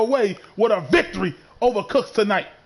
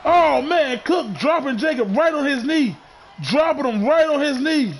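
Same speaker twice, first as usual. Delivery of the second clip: hyped ringside call, higher pitch; wrestling commentator; 5 semitones higher than usual. Acted. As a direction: call the big move with energy. Live play-by-play builds up.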